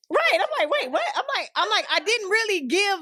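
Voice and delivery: high-pitched, laughing protest; amused disbelief. Real, not acted. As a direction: protest incredulously through a laugh.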